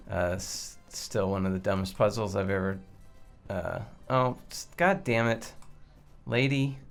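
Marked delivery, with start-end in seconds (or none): none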